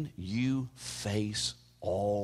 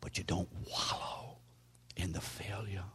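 softer, low, whispered advice; whispered warning; 3 semitones lower, 9 dB quieter than usual. Acted. Preacher urging listeners not to wallow, whispered. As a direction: lean in and warn them gently.

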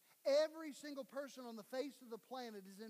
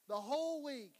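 louder, punched out; emphatic; 8 dB louder than usual. Acted. Pastor to congregation about honesty, emphatic. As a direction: drive home the whole week.

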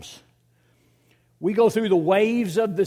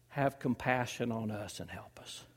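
breathless, slow whispered plea; desperate whisper; 8 semitones lower, 10 dB quieter than usual. Acted. A father whispering his plea to Jesus. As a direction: barely get the words out, whispering. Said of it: "all the man can get out of his mouth is this".

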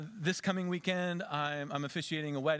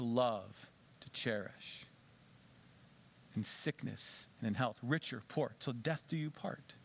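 solemn vow reading; 5 semitones lower, 4 dB quieter, slower than usual. Retold or imitated. Reading wedding vows.

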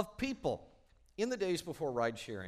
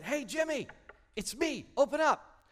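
much higher, loud call through a door; 12 semitones higher, 7 dB louder than usual. Retold or imitated. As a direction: hail a buddy to open up. Clerk calling through door.

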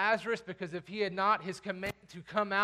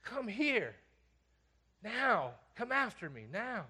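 intense command; 3 semitones higher, slower than usual. Acted. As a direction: summon urgently without shouting. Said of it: "And he said".

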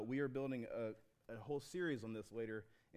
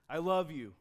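louder, earnest I love you; Leia's declaration; 3 semitones higher, 7 dB louder than usual. Acted. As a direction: say it like Leia to Han. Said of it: "Harrison Ford and Princess Leia".

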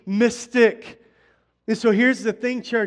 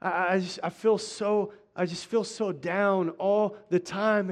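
softer, lightly voiced down guy; mopey churchgoer; 2 semitones lower, 7 dB quieter than usual. Pastor imitating a struggling guy, a softer mopey voice. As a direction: mumble it like a discouraged young man. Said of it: "Talk to people, it's like".

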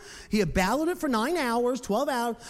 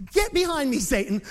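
acted rebuke; 6 semitones higher, 5 dB louder than usual. Voicing Jesus rebuking Peter.

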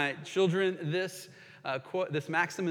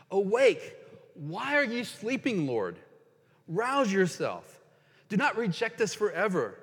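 pleading psalm reading; 2 semitones higher than usual. Acted. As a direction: plead with a God who seems asleep. Reads Psalm 44 aloud.

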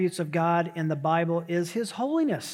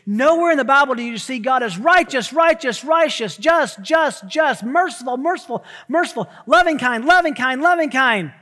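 emphatic preaching; 6 semitones higher, 9 dB louder than usual. Acted. Preacher hammering repeated attributes.

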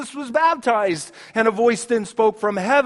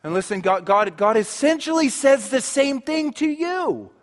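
usual speaking voice

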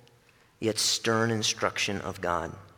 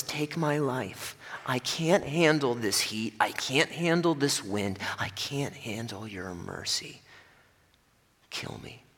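whining Jonah. Acted. Jonah whines to God, fading to a whispered kill me. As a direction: let it fade to a breathless plea. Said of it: "Jonah says".

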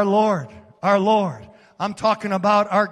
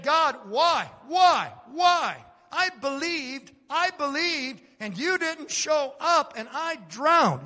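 higher, pleading why, then softer; a drowned woman's hurt complaint to God; angry woman crying out; 6 semitones higher, 4 dB quieter than usual. Acted. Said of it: "She was angry".

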